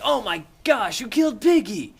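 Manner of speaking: high, loud outraged cry; mock horror. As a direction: exclaim in exaggerated shock. Ralph's horror, acted.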